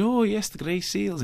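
high, slow, bad Scottish accent; Jenny Seagrove impression. Film critic mimics the actress spotting seals, hammed-up bad Scottish accent. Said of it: "impression of Jenny Seagrove doing a bad accent".

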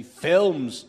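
loud demonstrated one-word announcement; announcer's call. Shown as a demonstration. Host showing announcer her ten-minute cue, loud call. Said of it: "if you could just say".

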